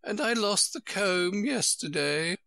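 high queen voice with a mild sigh; high fussy queen, lightly sighing. Acted. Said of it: "with a sigh".

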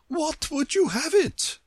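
very high-pitched queenly question; imperious Queen. A narrator's reading. Queen questioning surprised Alice, high imperious voice.

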